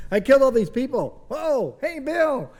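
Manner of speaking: high, excited deadpan comic voice; Norm Macdonald. Retold or imitated. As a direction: pitch it way up and play the bewildered prankster. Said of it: "that's really good Norm Macdonald impersonation".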